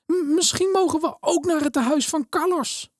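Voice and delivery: put-on a poor girl asking the minister a favour; pleading little girl. A narrator's reading. Elena begs the minister to take Rosa too, high and pleading.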